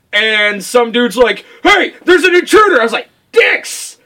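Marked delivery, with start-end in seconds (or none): 1.6-2.8 high-pitched acted alert; mock alarm call; guard raising alarm. Voicing guard spotting an intruder. 3.3-4.0 high, sudden exclamation; acted cry of dismay; mock panicked outburst. Acting out his reaction when spotted.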